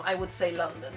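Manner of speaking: ordinary delivery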